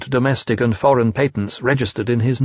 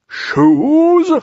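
high, loud innocent owl; feigned surprise; 6 dB louder than usual. A narrator's reading. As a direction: act innocent, shocked. Owl voiced in story.

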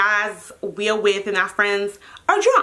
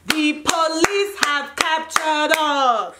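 slow, drawn-out, higher announcement; dramatic proclamation; 4 semitones higher, slower than usual. Retold or imitated. Announcing to listeners that police caught the group, slowly dramatic. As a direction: proclaim each word with mock grandeur.

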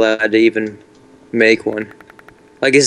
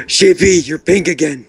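high gasping surprise; surprised gasp; 6 semitones higher than usual. Real, not acted. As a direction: gasp and point it out, amazed. Gasping at pink sheep.

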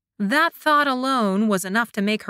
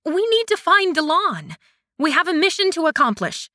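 higher, louder flustered insistence; indignant, much higher and louder; bristling; 9 semitones higher, 4 dB louder than usual. A narrator's reading. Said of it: "suddenly bristling".

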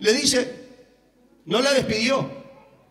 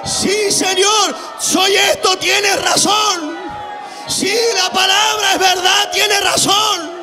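pleading woman; 4 semitones higher, much slower than usual. Acted. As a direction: cry out humbly, drawn out and high like the begging woman. Preacher voicing the humbled woman.

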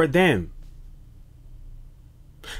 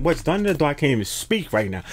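normal talking voice

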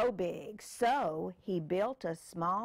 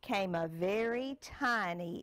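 put-on reading to a child; 2 semitones higher than usual. Parent reads picture book aloud.

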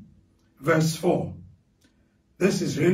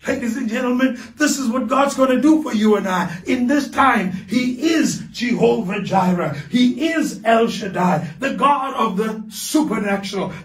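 preaching at full force; 3 semitones higher than usual. Acted. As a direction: lift the congregation with a fervent proclamation.

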